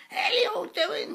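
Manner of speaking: very high, squeaky spirit voice; drawn-out, high-pitched cheeky greeting; Mickey. Retold or imitated. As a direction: squeal it slowly in a put-on voice. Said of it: "with his voice".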